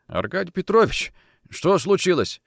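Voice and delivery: high, bewildered question; anxious question. Acted. Bedridden Kochkin to his boss, anxious question. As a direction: ask with worried surprise. Sick man startled by boss's arrival.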